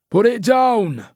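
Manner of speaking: loud, high commanding shout; police shout. A narrator's reading. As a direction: order him to drop the knife. Detective shouts at knifeman.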